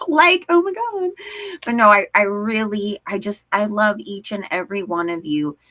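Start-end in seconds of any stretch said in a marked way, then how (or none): 0.1-1.1 put-on high, loud Valley girl gush. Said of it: "like a Valley girl".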